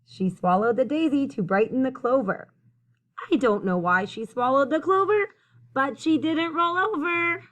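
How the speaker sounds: lively storytelling, climbing high on the last line; building rhyme read-aloud. A narrator's reading. Reader carrying children through the repeated rhyme, building read-aloud.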